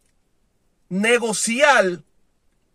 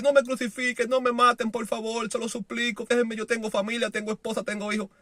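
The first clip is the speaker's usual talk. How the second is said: soft begging for mercy, voice sinking; mock tearful plea; 8 dB quieter than usual. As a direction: imitate a cowardly plea, softly. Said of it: "ponerse a llorar y decir".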